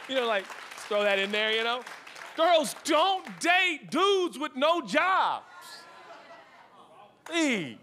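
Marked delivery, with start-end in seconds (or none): 2.3-7.8 slow, high, word-by-word exhortation; full-force preaching. Real, not acted. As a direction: punch out each word as a warning.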